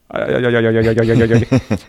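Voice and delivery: rapid repeated gibberish syllables; mock panicked babble. Hunter acts out freezing with buck fever, jittery babbling. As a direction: jabber helplessly on one repeated sound.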